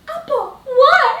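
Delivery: high, slow, stunned mock shock; reacting in total disbelief. She reacts to the taxi man's correction, stunned and high.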